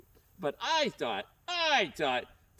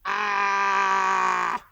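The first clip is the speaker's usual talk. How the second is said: bad guys' scream. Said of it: "all the bad guys go".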